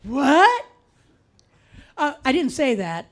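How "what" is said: shocked. Retold or imitated. Shock at the news.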